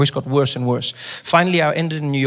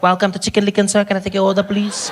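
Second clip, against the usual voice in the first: higher, put-on service greeting; counter woman; 5 semitones higher than usual. Chicken Licken woman greeting customer, high service voice. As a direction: pitch it up like a fast-food cashier.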